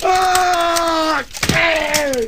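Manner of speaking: high hammed-up scream; long wail of mock terror; shrieking as the car goes over; terrified scream. Acting out going over cliff.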